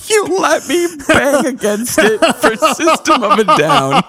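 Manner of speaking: high squeaky laughing reread. Real, not acted. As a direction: crack up mid-sentence. Rereading the line through laughter.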